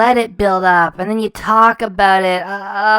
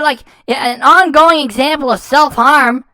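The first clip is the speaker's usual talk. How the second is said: normal talking voice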